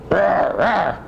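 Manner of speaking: light, playful rawr; a mock growl for a child; lion roar. Shown as a demonstration. Grandpa growling like a lion. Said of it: "Oops, that's a lion".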